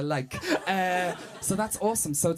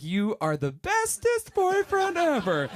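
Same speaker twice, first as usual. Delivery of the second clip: put-on high, slow, gushing girlfriend; high cooing girlfriend; mock girlfriend voice; 10 semitones higher, slower than usual. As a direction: coo it like a doting girlfriend. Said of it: "she is like".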